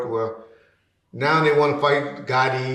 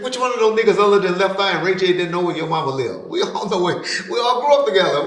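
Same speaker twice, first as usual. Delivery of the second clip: heated retort; 6 semitones higher, 4 dB louder, faster than usual. Retold or imitated. Arguing back with Ronald.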